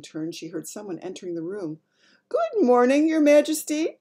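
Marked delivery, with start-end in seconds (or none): 2.3-4.0 put-on high, loud elderly maid; old maid voice. A narrator's reading. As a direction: greet the Queen as an old maid. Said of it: "the voice of an oldish person".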